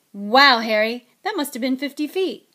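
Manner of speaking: loud, excited wow; impressed. A narrator's reading. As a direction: call out in boyish amazement. Boy impressed by the long throw.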